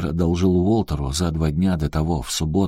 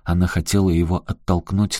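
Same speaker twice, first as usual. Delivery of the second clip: ordinary delivery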